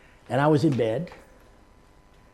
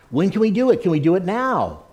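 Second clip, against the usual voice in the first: eager; 5 semitones higher, 7 dB louder than usual. Retold or imitated. Young woman eager to pray now.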